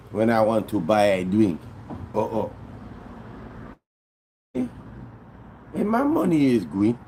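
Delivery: high-pitched imitation of a drunk Haitian man; drunk Haitian accent. Drunk Haitian guy protesting being cut off at the bar, imitated Haitian accent. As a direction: plead and protest in a rough attempt at a Haitian accent. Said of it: "I can't really do a good Haitian accent".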